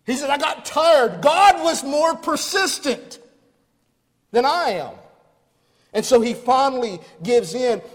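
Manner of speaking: loud, high, rousing sermon climax; preaching at full force. Real, not acted. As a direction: push it out to the back pews with fire.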